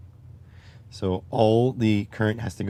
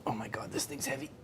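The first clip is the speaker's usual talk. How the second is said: strained whisper; 3 semitones higher, 13 dB quieter, faster than usual. Real, not acted. Lifting heavy battery.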